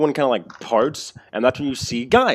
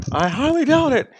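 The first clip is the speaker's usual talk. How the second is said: npc retort; 6 dB louder than usual. Acted. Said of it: "he says".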